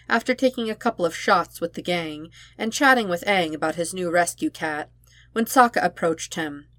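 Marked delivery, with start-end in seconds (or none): none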